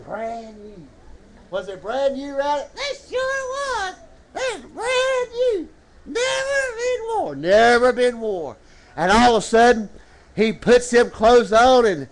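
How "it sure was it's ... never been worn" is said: very high, softer puppet voice, drawn out. Pretend. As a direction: answer slowly in a squeaky puppet pitch. Puppet Rowdy answers the preacher.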